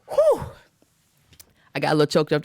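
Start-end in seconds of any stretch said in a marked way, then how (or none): 0.1-0.4 sharp high exhale, overwhelmed; high breathy whew of emotion. Real, not acted. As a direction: blow it out, moved. Reaction after recapping scene.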